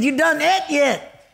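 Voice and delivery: a hammed-up country cook repeating his question. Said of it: "they talk with an accent like this".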